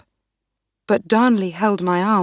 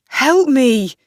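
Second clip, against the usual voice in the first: higher, louder panicked scream; screaming for help; 6 semitones higher, 7 dB louder than usual. Acted. Riccio dragged away, screaming to the queen for help. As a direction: scream for your life. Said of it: "he screamed".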